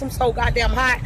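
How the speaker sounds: ordinary delivery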